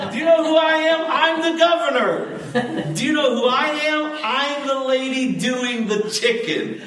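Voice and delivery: very high and loud through laughter; the preacher replaying governor and chicken lady, laughing; laughing mock standoff. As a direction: squeal both sides of the quarrel, laughing.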